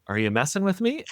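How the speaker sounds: retold disbelief, pitch jumps up; shell-shocked disbelief. Host recalls asking George in shock, high incredulous question. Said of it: "shell-shocked in that moment".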